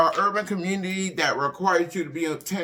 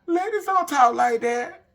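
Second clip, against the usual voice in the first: laughing through the words, higher; 11 semitones higher than usual. Real, not acted. Telling the audience ladies don't curse, laughing. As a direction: crack up as you say it.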